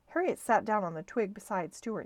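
plain reading voice

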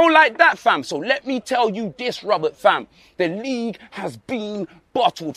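ordinary talk

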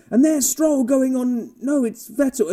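high mock commentator, fumbling the call. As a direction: play the confused commentator correcting himself. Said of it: "and they went".

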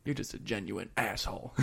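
a hint of a tough guy, low and quiet; ripped big dude. Imitating the ripped guy insulting Jeff, low and understated. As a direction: quote the big man's insult low and softly. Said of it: "and he's ripped".

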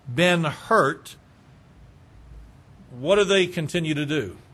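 ordinary talk, nothing marked

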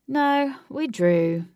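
slow, glum reply. A narrator's reading. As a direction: drag it out glumly. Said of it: "Huey said glumly".

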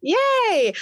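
high excited yay; excited cheer. Real, not acted. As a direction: cheer it out happily. Cheering her guest.

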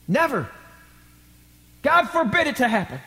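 high-pitched, adamant protest; preacher voicing indignant disciples; emphatic refusal. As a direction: reject the very idea. Acting the other disciples' refusal.

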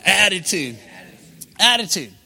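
punchy, emphatic "attitude"; with some attitude. Shown as a demonstration. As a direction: say "attitude" with sass, as a rallying cue. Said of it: "Now say it with some attitude".

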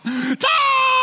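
very high, loud imitated scream of a name; mom's alarmed shout. Wife trying to wake up her husband, high mock scream. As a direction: shriek it like a wife trying to snap him out of it. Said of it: "she's trying to wake him up".

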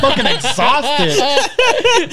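words stretched out through a laugh. Real, not acted. Laughing while telling sickness.

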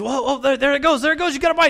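a dad who suddenly sees the rod twitch; excited dad. Retold or imitated. Dad spots a bite.

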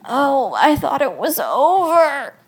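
loud, high, drawn-out wail of dismay; pained groan. A narrator's reading. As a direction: wail it slowly through a birth pang. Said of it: "I groaned as another pang".

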